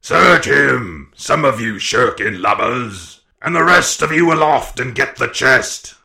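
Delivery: loud, scolding commands from a blind pirate; swearing, cried orders. A narrator's reading. Blind man to his shirking crew, loud angry orders. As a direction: cry the orders out at lazy men. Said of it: "he cried".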